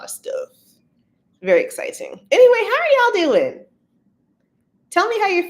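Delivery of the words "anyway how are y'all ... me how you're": high, loud greeting to the crowd; excited call-out. Real, not acted. Streamer checking in on her live audience, excited. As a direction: call out brightly, pitched way up.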